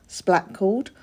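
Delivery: plain reading voice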